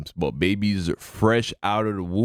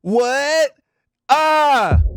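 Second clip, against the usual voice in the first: stunned shouts; 9 dB louder, much slower than usual. Real, not acted. Shocked by five months pregnant.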